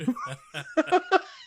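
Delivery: high burst of laughter at own joke; big laugh. Real, not acted. Laughs after own impression.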